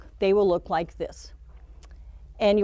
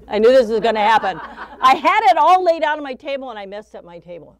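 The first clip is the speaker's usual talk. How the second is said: loud, fast flustered complaint with laughter; laughing exasperation; 3 semitones higher, 9 dB louder, faster than usual. Real, not acted. Teacher messed up her laid-out table, laughing exasperation. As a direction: rush it out, laughing at your own mishap.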